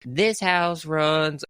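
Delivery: put-on slow, high Southern older lady drawl; Paula Deen impression. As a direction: draw it out like a Southern grandma. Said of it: "my best Paula Deen impression".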